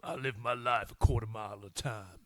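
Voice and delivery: deep voice. Shown as a demonstration. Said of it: "put my deep voice on for this".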